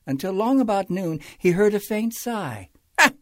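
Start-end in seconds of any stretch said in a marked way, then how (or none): 3.0-3.1 high, loud irritated snap; snapping king. A narrator's reading. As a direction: bite off the question sharply. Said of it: "snapped the king".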